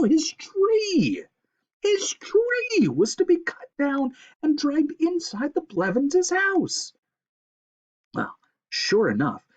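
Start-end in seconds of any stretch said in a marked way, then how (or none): 0.0-6.9 high, slow stricken narration; squirrel's horrified realization; dismay. A narrator's reading. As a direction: realize the horror.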